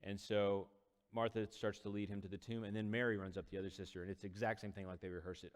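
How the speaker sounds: normal talking voice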